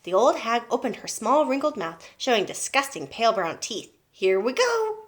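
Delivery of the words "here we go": high-pitched eager cry; excited cry. A narrator's reading. Boy spooning medicine into grandma, excited cry. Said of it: "cried George".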